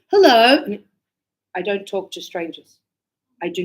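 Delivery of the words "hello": loud, bright call of hello; a stranger calling out a cheery hello; loud stranger greeting. Retold or imitated. A stranger greets her in the street, loud hello. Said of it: "and says".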